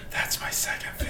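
unvoiced whisper with a laugh behind it; mock intimate whisper. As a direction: pretend to whisper into someone's ear, very soft. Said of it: "he whispers in your ear".

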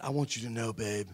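low, soft, sweet-talking a girlfriend, hammed up; mock tender confession. Preacher to his first girlfriend, mock tender and soft. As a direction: murmur it like a shy teen in love. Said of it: "I said".

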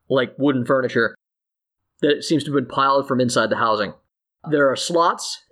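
ordinary talk, nothing marked

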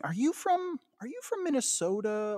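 high-pitched quoted questions; imitating curious clients.

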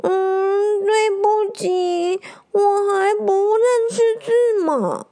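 put-on high, slow little-kid voice, almost tearful; small hedgehog boy, sheepish and whiny; timid hedgehog. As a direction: apologize like a shy child caught out. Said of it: "小小声地说".

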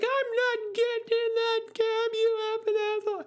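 high piercing shriek, fast at the end; drunk woman shrieking. Retold or imitated. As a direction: screech the refusal like a drunk woman. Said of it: "And she's like".

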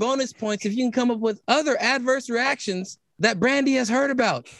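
normal talking voice

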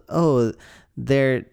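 ordinary talk

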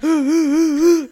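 sharp loud gasp for air. Retold or imitated. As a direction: gasp loudly like sobbing. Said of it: "And I'm like".

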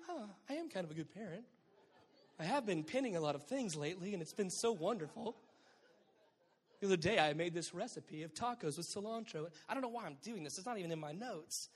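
preen over being a good parent; smug proud parent. Retold or imitated. Preacher voices a mom pleased to be praised, smug and giggling.

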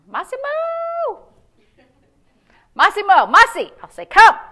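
high, loud call stretched out across the yard; long, high call for the dog, ending in a loud command. Pretend. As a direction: call out to the dog far away. Calling the dog outside.

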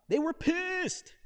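high, loud, drawn-out indignation; emphatic outrage. Retold or imitated. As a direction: play up the outrage, stretching the words out. Dramatizes the eunuch's rage.

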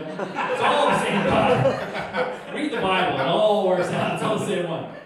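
slow, loud, emphatic, half laughing; loud and emphatic over a laugh; loud insistence. Real, not acted. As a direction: hammer the point home. Insisting one God.

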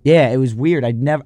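ordinary talk, nothing marked

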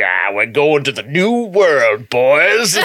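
slow, drawn-out mock Texan drawl; weird Texan accent. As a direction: ham it up as a Texan cowboy. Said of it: "in, like, this weird Texan accent".

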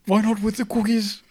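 mock offended archduke. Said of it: "echoing the mock archduke".

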